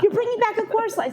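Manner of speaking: high, fast, loud delighted voice; excited caller. Retold or imitated. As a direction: blurt it out, overjoyed.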